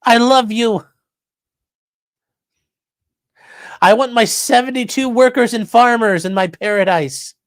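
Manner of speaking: slowed, dreamy mock reverence; sarcastic dying activist's ecstasy; mock dying rapture. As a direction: sigh it like a blissful martyr. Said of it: "he's like".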